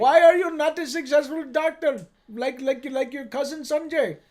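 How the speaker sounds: mock parental reproach in an Indian accent; lilting put-on Indian accent. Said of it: "That's a good Indian accent".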